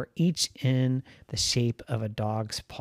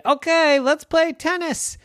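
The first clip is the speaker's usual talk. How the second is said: Rhonda's voice; 13 semitones higher, 4 dB louder than usual. Acted.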